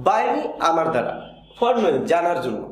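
normal talking voice

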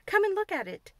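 high, loud, eager invitation; exclaiming. A narrator's reading. Boy showing Mary a snake charmer picture, exclaiming. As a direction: call out excitedly, pitched well up. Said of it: "he exclaimed".

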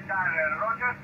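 ordinary talk

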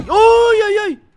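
high, loud startled "ay, ay!"; jolted, loud outcry; startled yelp. Real, not acted. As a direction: yelp in sudden fright. Startled during horror game.

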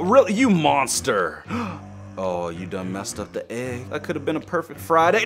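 ordinary talk

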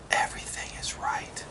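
soft blissful whisper. Real, not acted. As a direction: breathe it out in a whisper. Savoring hot food bliss.

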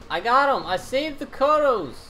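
high, loud victory cheer; excited cheer. Real, not acted. Gamers celebrating saving the Kodos, with high excited cheers. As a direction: cheer the win.

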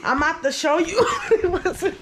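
quick words breaking into laughter; laughing explanation. Real, not acted. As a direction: start explaining, then crack up. Laughing while explaining the prank.

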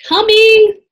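loud, high, sung-out answer; calling back. A narrator's reading. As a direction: holler back from a distance. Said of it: "Jack called".

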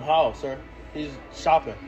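normal talking voice

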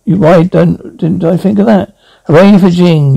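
normal talking voice

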